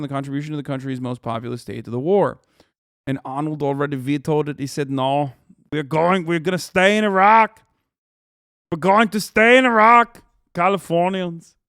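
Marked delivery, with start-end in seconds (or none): 4.9-7.6 loud, slow Austrian bodybuilder accent, badly done; hammed-up Austrian accent rising on "Iraq"; clumsy Arnold Austrian accent, building louder; Arnold impression. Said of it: "my Arnold impersonation is bad". 8.7-11.6 put-on louder, higher Austrian accent, pushing "stay" hard; bellowing bad Austrian accent, slowing on "Californians"; over-the-top Austrian governor accent; Arnold impression. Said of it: "my Arnold impersonation is bad".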